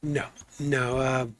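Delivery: ordinary talk, nothing marked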